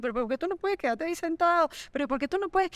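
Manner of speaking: high, pleading, exasperated questions; exasperated scolding. Retold or imitated. Mother scolding her young son, high and exasperated. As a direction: nag him like a frustrated mother.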